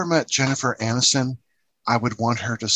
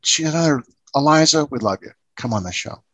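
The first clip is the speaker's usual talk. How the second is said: ordinary talk, nothing marked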